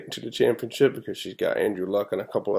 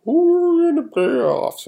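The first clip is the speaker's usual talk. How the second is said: much higher, mocking smug fan voice; 5 dB louder, slower than usual. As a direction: sneer it like a carefree Seahawks fan. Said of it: "because we're like".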